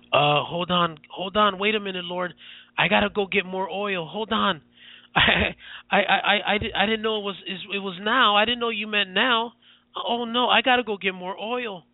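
high, panicky stammering excuses to the Lord; hammed-up panic, caught without oil; flustered unprepared believer. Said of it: "what are you gonna say?".

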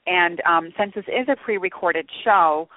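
normal talking voice

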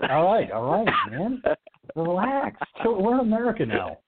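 high mock calming; high teasing chill-out; mock soothing. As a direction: placate him theatrically. Calming down friend, mocking.

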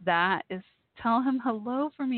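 ordinary delivery

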